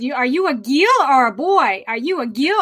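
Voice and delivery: put-on "gil" with no R, as a Kiwi child says it. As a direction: mimic the daughter asking, dropping the R in "gil". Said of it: "She says".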